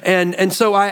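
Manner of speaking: usual speaking voice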